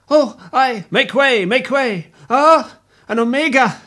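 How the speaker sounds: breathless, high, loud cries of alarm. Acted. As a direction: push through the crowd in a panic. Panicked rush through crowd.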